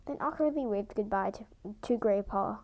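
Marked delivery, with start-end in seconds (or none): none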